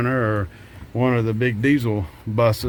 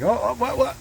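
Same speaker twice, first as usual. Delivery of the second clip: high, baffled oh what; imitating a startled, lost viewer; confused surprise; 11 semitones higher than usual. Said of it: "and then you're like".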